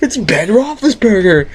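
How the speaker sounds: very high, amazed; mock high excited recognition; starstruck. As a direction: gasp it out starstruck. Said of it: "I'm like".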